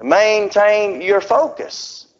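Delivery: drawn-out, very slow urging; slow earnest charge; slow command. Retold or imitated. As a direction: stretch every word. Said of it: "He said".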